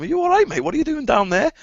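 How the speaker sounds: high-pitched acted-out quote; retold exchange. Biker retelling the row with a man he knocked over, high mock voices.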